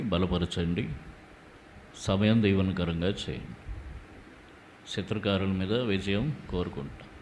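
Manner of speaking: hushed, reverent opening prayer. Acted. Pastor praying to Jesus before the sermon, hushed and reverent. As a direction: pray it softly to the Lord before preaching.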